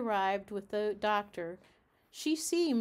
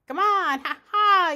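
very loud, high magpie cackle; shouted; 8 semitones higher, 11 dB louder than usual. Acted. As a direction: jeer loudly at the peeping cat. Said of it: "he shouted".

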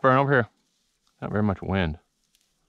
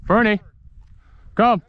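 calling the dog; 8 semitones higher than usual. Real, not acted. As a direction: call her in from far off. Calling dog across the field.

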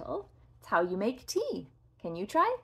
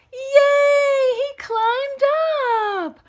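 high, loud cheering yay; excited cheer; 14 semitones higher, 8 dB louder, slower than usual. Real, not acted. Koala climbs to the top, high loud cheer.

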